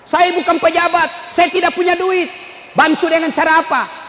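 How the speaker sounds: high, emphatic declarations; rousing preaching. Real, not acted. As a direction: proclaim each line to the congregation. Preaches at full force.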